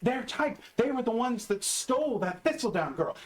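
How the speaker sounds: high, agitated storyteller; agitated insistence. Acted. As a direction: insist on it, pitched well up. Character insists the goblins are real.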